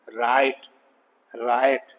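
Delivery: राइट with "ai" gliding into "uh"; diphthong plus schwa. Shown as a demonstration. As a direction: sound out the glide in राइट. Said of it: "पहले आई की आवाज".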